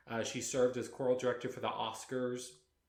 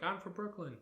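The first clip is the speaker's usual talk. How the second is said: put-on higher, a quick Brooklyn accent; 8 semitones higher than usual. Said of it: "in the traditional Brooklyn accent".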